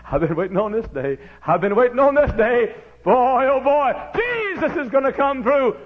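high, loud eager cry; bursting with anticipation; excited Zacchaeus. Acted. Zacchaeus crying out that he's been waiting.

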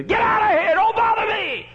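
very high, loud yell to go away; furious woken neighbour; angry shout. Acted. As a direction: chase him off the doorstep. Neighbour drives the beggar away.

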